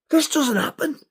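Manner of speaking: high-pitched, bewildered protest; stunned disbelief. Retold or imitated. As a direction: protest it high, unable to take it in. Acted disbelief at meeting idol.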